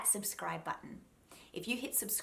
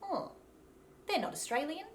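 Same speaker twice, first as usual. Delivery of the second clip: doubting listener; 9 semitones higher than usual. Acted. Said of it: "will make someone go".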